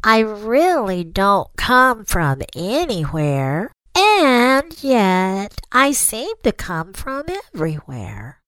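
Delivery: high, very slow turtle voice. A narrator's reading. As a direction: drag each word out slowly. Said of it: "the turtle said very slowly".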